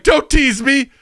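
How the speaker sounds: high, loud, hungry demanding voice; greedy toilet. Pretend. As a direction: demand it like a starving beast.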